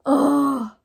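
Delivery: loud, high oh of shock; a hit-in-the-heart oh; stunned oh. Real, not acted. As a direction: let it land like a punch. Reacting to emotional book passage.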